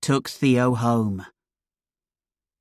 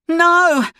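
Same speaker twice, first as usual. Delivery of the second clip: high, loud cry of no; 5 dB louder than usual. Acted. Son cries out as the robber raises his gun, high loud shout. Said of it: "Theo cried".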